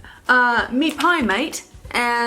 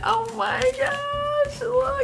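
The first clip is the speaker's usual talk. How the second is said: high, slow delighted gush; excited gushing; 10 semitones higher, slower than usual. Real, not acted. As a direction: gush over the toy koala, drawn out. Excited over koala toy.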